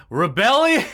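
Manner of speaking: loud, high announcer cry; excited shout. Acted. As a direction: belt out the studio name. Hyped exclamation of studio name.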